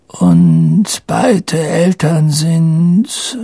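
put-on slow, high old man's question; frail old headmaster. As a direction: draw the question out feebly. (langsam fragender alter Schulleiter)

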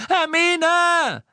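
very high, loud desperate scream; drawn-out frantic yell; roaring. A narrator's reading. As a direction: bellow the name in panic. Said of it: "brüllte Ron".

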